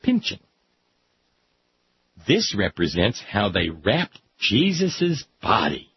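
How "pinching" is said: put-on little child echo. Child echoing word.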